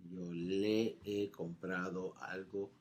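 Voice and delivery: "Yo le he comprado" spelled out slowly, "le" leaned on; slow model. Shown as a demonstration. Teacher slowly modeling "Yo le he comprado" for students. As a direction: lay each word out slowly. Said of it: "teacher repeats the model slowly".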